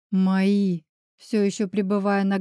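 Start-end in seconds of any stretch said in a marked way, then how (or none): none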